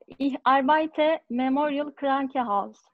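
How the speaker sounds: usual speaking voice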